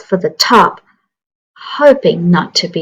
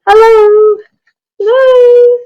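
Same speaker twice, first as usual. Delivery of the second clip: very high squeaky hello, louder; tiny mouse calling out; 4 dB louder, slower than usual. Acted. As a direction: call out unheard like a tiny mouse.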